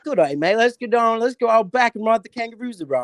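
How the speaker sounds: high-pitched, hammed-up fake Aussie; mock Australian tourist-ad patter; terrible Australian accent. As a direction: put on a bad Australian accent. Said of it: "terrible accent".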